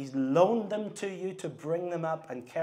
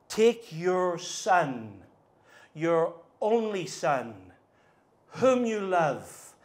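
each phrase slowed and pressed, louder; slow emphatic quote; 3 semitones higher, 4 dB louder, much slower than usual. Acted. Preacher voicing God to Abraham, slow and weighty. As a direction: spell out every word of God's command. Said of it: "highlight it in your Bible".

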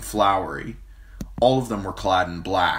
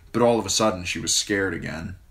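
ordinary delivery, nothing marked

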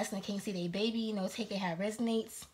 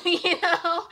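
laughing; 8 semitones higher, 8 dB louder than usual. Real, not acted. Laughing at own point.

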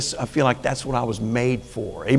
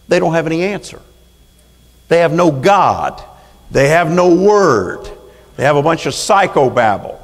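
raised, louder angry indignation; angry preaching; 5 semitones higher, 6 dB louder than usual. Real, not acted. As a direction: hammer it home with real anger. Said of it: "if I sound angry, I am angry".

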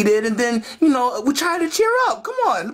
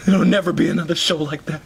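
low, gloomy certainty; mournful; 12 semitones lower, faster than usual. Acted. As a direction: grieve it in a sunken voice. Depressed father mourns show.

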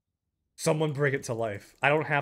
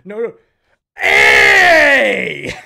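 very loud cry breaking into laughter; joyful shout; 3 semitones higher, 16 dB louder, much slower than usual. Real, not acted. Finally gets a good drop.

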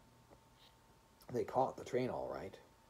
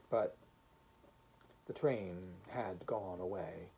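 usual speaking voice

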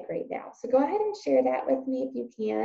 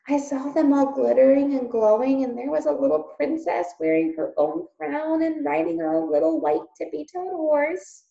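put-on excited child; 6 semitones higher, 5 dB louder than usual. Meg excitedly describes royals.

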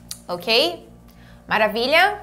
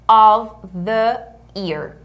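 of, the, year separated and drawn out; slow word-by-word demonstration; slow modelling; 5 dB louder, much slower than usual. Shown as a demonstration. Teacher drilling months of the year, word by word.

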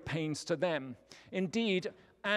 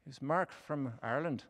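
put-on Irish accent; 4 dB quieter than usual. Preacher playfully puts on an Irish accent for friend Mark. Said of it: "tempts me to do an Irish accent".

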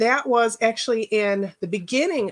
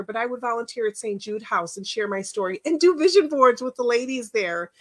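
ordinary talk

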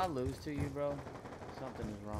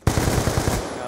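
loud no; 14 dB louder than usual. Real, not acted. Dying in game.

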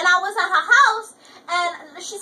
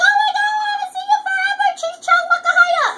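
excited greeting; 13 semitones higher, 4 dB louder, faster than usual. Retold or imitated. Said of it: "I ran up to this bitch like".

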